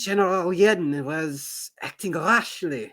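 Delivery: put-on French accent. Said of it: "my French accent".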